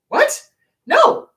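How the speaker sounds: high-pitched, loud, incredulous; appalled disbelief. Acted. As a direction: react aghast with sharp high bursts. Mock shock at dismissive parent.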